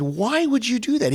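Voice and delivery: high-pitched retold incredulous question; appalled disbelief. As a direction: lift the pitch in baffled protest.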